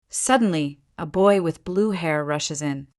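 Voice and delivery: ordinary delivery, nothing marked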